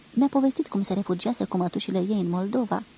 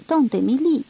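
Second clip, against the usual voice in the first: loud, high cry from the window; calling out; 5 semitones higher, 6 dB louder, slower than usual. A narrator's reading. Girl calls her aunt as train leaves, loud cry. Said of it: "a strigat și ea de mai multe ori".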